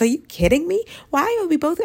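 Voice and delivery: can't believe it; incredulous. Real, not acted. Indignant rant at men.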